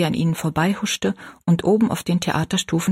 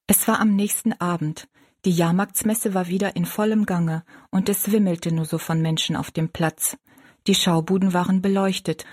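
usual speaking voice

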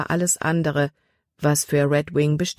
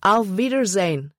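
calls goodbye across the deck, higher; calling out; 4 semitones higher than usual. A narrator's reading. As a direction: raise the voice and call it out. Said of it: "rief sie auf Deutsch". (laut rufend)

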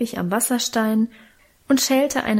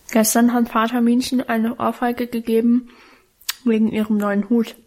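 muffled, halting talk while chewing; mouth full. A narrator's reading. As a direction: talk indistinctly round a bite of bread. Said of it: "undeutlich und stockend während des Kauens".

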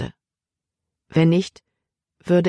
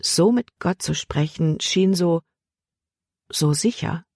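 ordinary delivery

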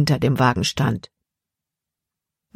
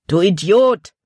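loud, higher outburst; exclaimed insult; 4 semitones higher, 4 dB louder than usual. A narrator's reading. Bandit scolds his partner Sam, loud exclamation. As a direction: blurt it at him like a slap. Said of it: "rief der andere Bandit aus".

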